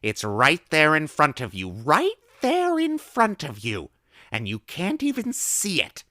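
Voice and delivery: high, loud, slow gleeful lecture; excited old woman. A narrator's reading. Old woman gleefully warning the boy about small print. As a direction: relish warning the child.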